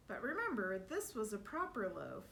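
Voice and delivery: usual speaking voice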